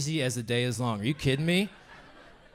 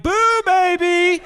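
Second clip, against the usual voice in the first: much higher, louder and drawn out slowly, with a laugh; a camp ghost springing out to spook them; shrieking slow spooky boo; hammed-up ghost boo; 9 dB louder, much slower than usual. Ghost popping in to scare.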